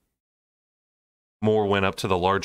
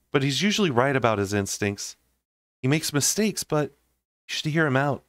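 higher, coaxing friend; voiced Mor, earnest advice; 5 semitones higher than usual. A narrator's reading. Mor urging Feyre to hear Rhys out, higher and earnest. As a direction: gently persuade her. Mor's voice in the story.

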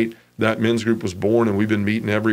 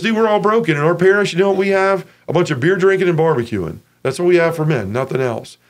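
much higher, louder, fired up; worked-up friend; 7 semitones higher, 3 dB louder than usual. Retold or imitated. Friend convinces him to start a group, higher and fired up. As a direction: sell it, get worked up. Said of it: "He goes".

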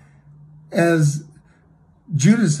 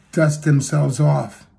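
ordinary talk, nothing marked

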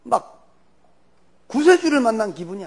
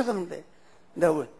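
normal talking voice

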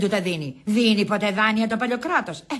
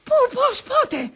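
high, staccato panic; urgent high-pitched alarm; alarmed questioning; slower than usual. Acted. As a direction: fire off startled questions. Urgent questions after shocking news.

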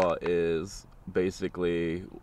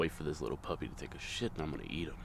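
coyote thoughts; 6 semitones lower, 13 dB quieter, much faster than usual. Pretend. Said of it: "Thoughts of a coyote".

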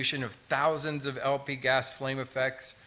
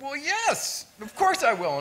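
much higher and louder, snapping to attention; eager yes-sir reply; 12 semitones higher, 6 dB louder than usual. Retold or imitated. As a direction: hurry to promise the sergeant, wide-eyed. Eager reply to scolding officer.

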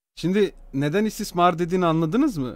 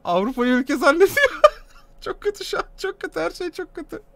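helpless high-pitched laughter through the words; laughing; 11 semitones higher, 4 dB louder than usual. Real, not acted. Reactor mocking a streamer's blunder, laughing helplessly.

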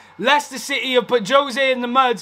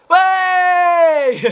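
jubilant shout; 3 semitones higher, 3 dB louder than usual. Real, not acted. Penalty given, fan celebrating.

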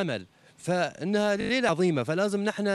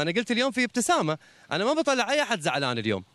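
ordinary talk

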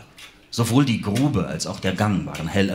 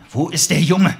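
shouted high and loud; demanding shout; 9 semitones higher, 7 dB louder than usual. Acted. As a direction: yell the question at him. Detective demands answer again.

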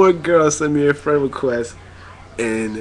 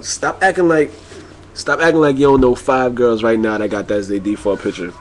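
ordinary talk

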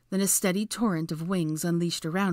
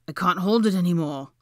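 louder, pressing desperation; urgent plea, louder; 7 dB louder than usual. A narrator's reading. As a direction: push it out like you can't wait. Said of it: "he said urgently".

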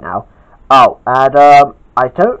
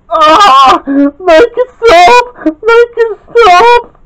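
loud, high, drawn-out wail; acted wailing sob; sobbing; 7 dB louder, much slower than usual. A narrator's reading. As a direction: bawl it slowly in distress. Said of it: "sobbed Gawain".

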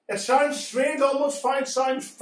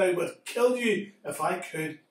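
cold threat; 4 semitones lower, 5 dB quieter than usual. Acted. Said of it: "the man said".

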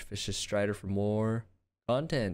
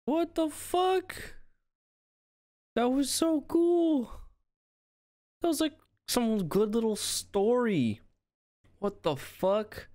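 very high, drawn-out falsetto amazement; awed excitement; much slower than usual. Real, not acted. Streamer reacting to a game trailer, high awed squeal. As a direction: stretch it out high, blown away.

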